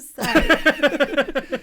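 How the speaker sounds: apologising through laughter; stretched-out words giving way to laughter; laughing. Real, not acted. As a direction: try to scold but crack up laughing. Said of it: "I'm laughing".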